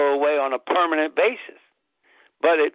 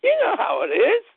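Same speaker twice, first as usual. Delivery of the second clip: much higher, fast mocking girlfriend voice; squeaky skeptical partner impression; mimicking girlfriend. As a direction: play her as baffled and dismissive. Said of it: "Kinda like".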